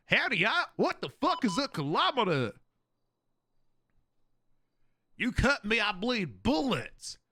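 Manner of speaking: hammed-up American cowboy twang; caricatured gun-loving American; mock American accent. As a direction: put on a high, boastful American accent. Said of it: "turning into your stupid fucking accent".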